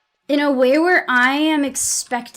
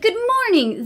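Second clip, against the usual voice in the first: mock cheery greeting; 9 semitones higher than usual. Mimicking cheerful coworker.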